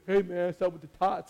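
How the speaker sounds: lightly mimicked mumbling teen movie line; quoting a favorite comedy line; Napoleon Dynamite quote. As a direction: quote the film, deadpan. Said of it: "it's like".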